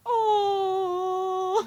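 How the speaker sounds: long, high, loud gushing coo; touched "aww". Acted. Looking back at fan drawings on a sad day, touched coo. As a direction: melt into a drawn-out high "oh". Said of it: "on a sad day I can look back and be like".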